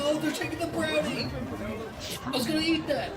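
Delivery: playful outrage. Real, not acted. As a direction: protest like someone stole your snack. Game character steals the brownie.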